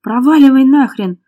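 high, forceful snarl; angry growl. A narrator's reading. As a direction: roar at them to go. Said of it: "рыкнул на Леру Макс".

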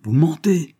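loud, high-pitched angry accusation. A narrator's reading. Colonel accusing the boy over his stork, angry. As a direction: hurl it angrily at the boy. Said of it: "fit monsieur Grünkopf avec colère".

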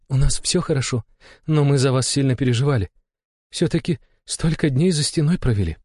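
countess voice. A narrator's reading. Countess speaks to husband.

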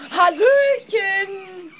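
high, drawn-out sing-song hello; calling out. Acted. Reporter calls out to find someone in the empty hotel. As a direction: call it long and high into the emptiness.

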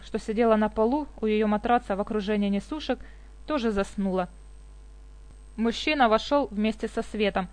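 usual speaking voice

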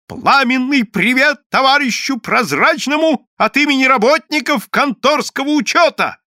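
high, loud public greeting; shouting to the crowd. A narrator's reading. Евсей shouts a greeting into the crowd, high and loud. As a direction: bellow the greeting across the square. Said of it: "крикнул в толпу".

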